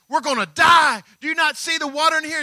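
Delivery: loud, fast, frantic cry; panicked yell. Retold or imitated. Disciples waking Jesus in the sinking boat, panicked. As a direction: blurt it out in terror. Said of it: "they said".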